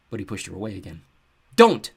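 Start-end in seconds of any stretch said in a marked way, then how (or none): 1.6-1.9 loud sharp snap; snapped. A narrator's reading. Seth snapping at his sister, loud. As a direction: bite it off hard. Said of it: "he snapped".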